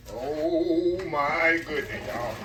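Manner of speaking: slow, stretched-out oh my goodness; long wondering exclamation; drawn-out awe. Real, not acted. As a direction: savor every word in amazement. Admiring the dish.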